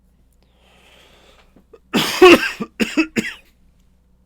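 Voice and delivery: loud hacking cough; hard cough. Real, not acted. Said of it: "It's got me coughing real hard".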